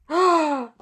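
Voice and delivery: loud, high mock gasp; shocked gasp. Comic friends learn it is no joke, shocked gasp. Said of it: "like, shocked".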